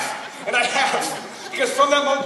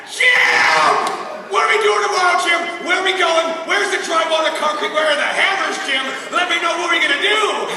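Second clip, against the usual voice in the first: high, eager rapid-fire questions; hammed-up impatient enthusiasm; excited pestering; 4 semitones higher, 3 dB louder than usual. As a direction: pepper the leader with questions, shouting. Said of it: "we're all excited".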